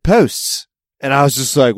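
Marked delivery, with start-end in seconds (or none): 0.0-0.6 POSTS with a Mid-Atlantic long o; Mid-Atlantic accent. Retold or imitated. Quoting the permitting guy to a friend, Mid-Atlantic accent. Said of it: "he did a Mid-Atlantic fucking posts".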